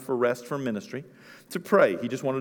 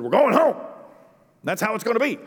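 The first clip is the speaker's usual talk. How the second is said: firm parental order; 7 semitones higher than usual. Retold or imitated. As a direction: end the discussion. Parent laying down the law.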